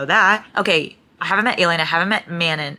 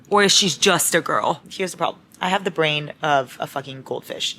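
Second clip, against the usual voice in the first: usual speaking voice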